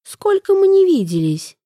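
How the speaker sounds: high-pitched put-on eagerness; feigned enthusiasm. A narrator's reading. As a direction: play up the delight at an old acquaintance. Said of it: "С наигранным энтузиазмом напустилась она". (с наигранным энтузиазмом)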